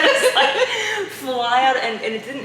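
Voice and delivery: high and loud, laughing through the words. Real, not acted. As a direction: keep laughing as she explains. Laughing recalling the panic.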